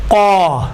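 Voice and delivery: قا with a thick, rounded long "aw"-like vowel; heavy alif. Shown as a demonstration. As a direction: say قا full-mouthed from the back. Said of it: "الف بھی موٹا تلفظ ہوتا ہے".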